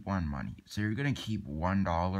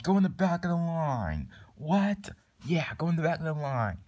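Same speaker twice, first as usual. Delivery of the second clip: higher-pitched, indignant telling-off; exasperated order; 8 semitones higher than usual. Acted. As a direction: send the cheat away, pitch raised. Acted scolding of queue cheats.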